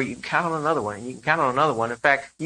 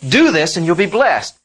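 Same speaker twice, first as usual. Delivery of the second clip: louder, higher, a firm demand; stern law voice; 4 semitones higher, 7 dB louder than usual. Shown as a demonstration. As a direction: lay down the law, firm and loud. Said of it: "The law said".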